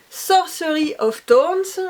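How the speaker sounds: "Sorcery of Thorns" said slowly with French sounds, not English; French pronunciation. Shown as a demonstration. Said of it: "je le prononce à la française".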